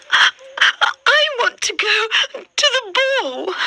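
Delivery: put-on high, slow girlish plea; Cinderella's voice. A narrator's reading. Cinderella begging the mice to go to the ball, high and slow. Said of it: "says Cinderella".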